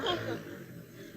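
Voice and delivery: a breathy imitated snore; soft snoring noise for the sleeping husband; snore imitation. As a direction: mimic a man snoring in his sleep.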